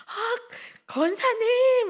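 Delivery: very high, loud, drawn-out greeting; big reaction. Shown as a demonstration. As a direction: greet her with huge enthusiasm. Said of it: "이렇게 좀 해드려야 되겠다". (아주 높고 크게 길게 반기는 인사)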